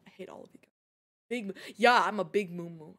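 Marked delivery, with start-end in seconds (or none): 0.1-0.7 very soft, voiceless grumble; mock-bitter whisper. Streamer to teasing chat, mock-bitter whisper. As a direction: whisper it under your breath.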